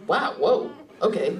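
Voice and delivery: usual speaking voice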